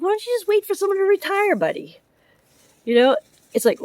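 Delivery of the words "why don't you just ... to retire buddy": sarcastic jab, much higher on the first line; a higher-pitched mock of the coworker; mocking retort. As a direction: throw it back at him with a sneer.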